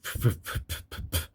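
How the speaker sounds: soft puffed snore sounds; puffing snore. Shown as a demonstration. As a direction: blow out little puffs like snores. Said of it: "it sounds like poof".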